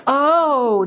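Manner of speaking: sudden loud realising oh; surprised oh. Real, not acted. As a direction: blurt it out in surprise. Realising what button.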